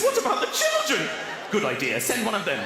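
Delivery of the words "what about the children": panicked squeak. Acted. Panicked wife.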